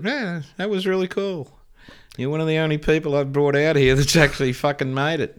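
praising surfer mate. Retold or imitated. Impressed local surfer praising the newcomer, lightly voiced.

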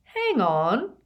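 loud, slow and puzzled; slowly. A narrator's reading. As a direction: drag it out as realization dawns. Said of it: "Ron said slowly".

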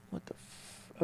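cut-off whispered curse; whispered aside. Acted. Host reacting to a dodged answer, whispered curse. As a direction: whisper it in disbelief.

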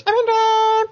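very high, bright squeaky rabbit; tiny rabbit voice. Acted. Voicing the game's rabbit as it walks the line, very high squeak. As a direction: squeak it like a little vector bunny. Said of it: "and he goes".

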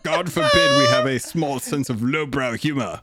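mock outrage. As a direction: huff like a pompous prude.

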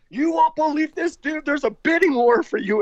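high, breathless rush of news; frantic. Retold or imitated. Manager Gordini phoning the singer with huge news, frantic and high. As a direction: retell a manager's frantic, disbelieving phone call. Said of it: "Gordini calls me frantic".

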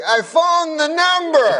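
high, slow mock Cosby; Bill Cosby impression. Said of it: "And he's like".

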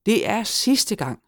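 high, slow emphatic declaration; exasperated vow. A narrator's reading. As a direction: swear off it for good. Gnome fed up, vows never again.

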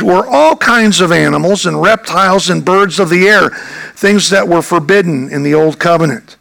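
ordinary delivery

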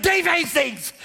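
high-pitched panicked blurt; frantic shout. Retold or imitated. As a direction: yell your name in a frantic panic. Frantic husband answering triage clerk.

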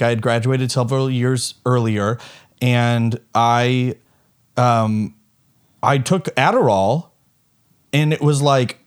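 normal talking voice

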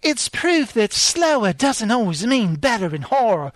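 lightly put-on British woman's accent; a hint of a British lady reading; British female voice. Rereading the female critic's quote in a mild British accent. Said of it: "my female voice has to be like a British accent".

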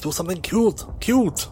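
high coaxing character voice; high pleading voice. Acted.

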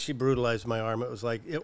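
usual speaking voice

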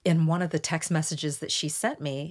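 normal talking voice

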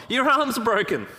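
high, loud mock exclamation; alarmed friend. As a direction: imitate a mate blurting out alarming news. Friend discovers the broken arm.

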